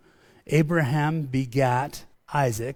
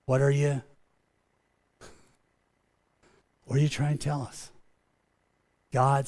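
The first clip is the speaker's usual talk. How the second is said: soft, halting prayer with long pauses; hushed prayer; 3 dB quieter than usual. Acted. Pastor pausing the sermon to pray softly to God. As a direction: pray quietly, letting silence sit.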